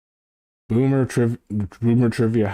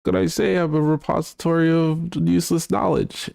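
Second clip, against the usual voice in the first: much higher, put-on Blue voice; impression of Blue; 9 semitones higher than usual. Streamer mocking her co-host Blue, much higher voice. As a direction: pitch it way up to mimic her friend. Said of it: "That was a pretty good Blue impression".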